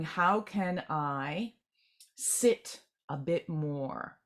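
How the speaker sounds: very slow, drawn-out question; slowed-down musing. Retold or imitated. Meditator asking how to sit longer, very slow. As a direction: stretch each word as if settling in.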